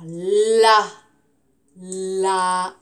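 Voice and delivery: "la" with a long, held A; drawn-out A sound. Shown as a demonstration. Teacher helps pupils hear the A, stretching "la". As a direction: hold the vowel of "la", loud and level. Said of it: "quand je fais le A".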